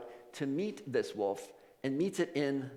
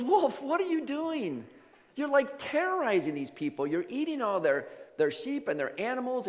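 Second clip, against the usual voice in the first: high-pitched, incredulous telling-off; acted reproach, pitched well up; scolding; 10 semitones higher than usual. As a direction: scold the beast like a naughty pet. Francis scolds the wolf.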